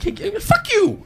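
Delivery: very high-pitched mock outburst; snapping. Employee snapping at the boss who insulted him, mock fury. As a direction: act out losing it, voice leaping up. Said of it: "I just snapped".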